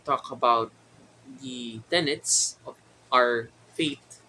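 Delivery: normal talking voice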